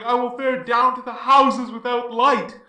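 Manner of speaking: normal talking voice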